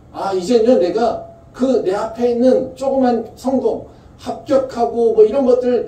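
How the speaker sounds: high, loud, slowed cry to God; preacher's fervent high cry; impassioned plea. Acted. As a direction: pour the confession out, rising. Impassioned voiced confession to God.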